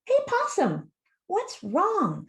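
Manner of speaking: high, bright-pitched call to a friend; high-pitched animal character voice; storybook skunk. A narrator's reading. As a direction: call out high with a concerned question. Skunk's line in read-aloud story.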